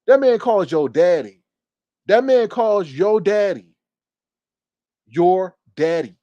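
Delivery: slow and loud, each word of "That man calls your daddy" weighted; indignant emphasis. Real, not acted. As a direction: hammer "your daddy" slowly, with a pause before repeating it. Scolding Jay Wilson over the insult.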